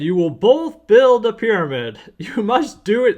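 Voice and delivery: playful pharaoh proclamation. Acted. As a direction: put on a pharaoh commanding his two sons. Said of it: "That's my pharaoh voice".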